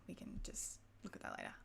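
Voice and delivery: whispered aside to herself; whispering. Real, not acted. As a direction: mutter it under your breath. Muttering to herself over settings.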